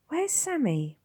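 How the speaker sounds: put-on high, timid child's voice; small boy. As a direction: ask like a weak little boy. Will, a frail boy.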